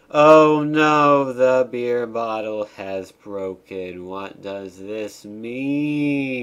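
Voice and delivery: hammed-up, slow-motion cutscene despair; melodramatic dismay. Cutscene character's dismay read aloud.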